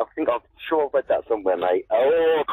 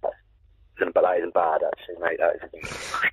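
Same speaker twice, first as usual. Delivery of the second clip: dolphin impression; 9 semitones lower, 3 dB quieter than usual. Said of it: "wicked dolphin impression".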